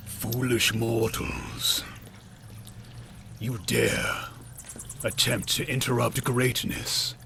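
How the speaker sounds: slow, menacing villain voice; cold rasping evil emperor. Acted. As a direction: sneer down at the intruders. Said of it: "the cloaked figure addresses you".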